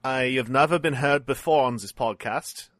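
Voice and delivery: put-on German accent. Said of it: "put on my stupid German accent".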